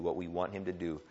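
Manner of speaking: usual speaking voice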